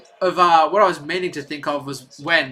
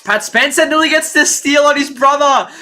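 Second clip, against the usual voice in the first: excited; 7 semitones higher, 5 dB louder than usual. Real, not acted. Brothers face off, streamer reacts.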